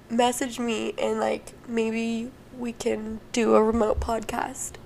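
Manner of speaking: ordinary talk, nothing marked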